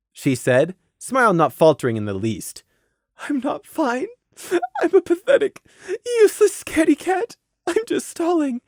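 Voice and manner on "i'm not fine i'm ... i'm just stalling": very high, tearful inner voice; tearful despair. Acted. Mare's hidden thoughts behind her smile, tearful despair. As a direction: berate yourself on the verge of sobbing.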